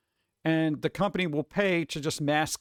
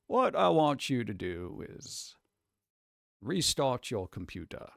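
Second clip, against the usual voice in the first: Morgan Freeman voice; 3 semitones lower, 4 dB quieter than usual. Retold or imitated. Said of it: "sound like James Earl Jones or Morgan Freeman".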